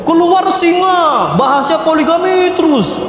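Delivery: mock-fierce lion shout; lion roar. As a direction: bellow it like a lion king. Husband roars like lion outside.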